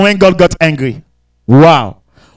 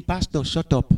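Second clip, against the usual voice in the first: God's low, much softer put-down; quiet stern rebuke; 5 semitones lower, 11 dB quieter than usual. Acted. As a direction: cut him off quietly, unimpressed. God rebukes the pastor.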